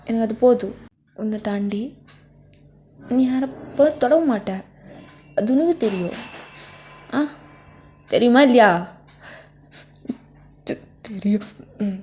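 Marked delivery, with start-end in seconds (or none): 3.0-8.7 building to a loud, higher yell on இல்லையா; angry demand. Acted. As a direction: push harder until you shout the question. Jealous boyfriend demands an answer. (கோபமாக கத்தி கேட்பது)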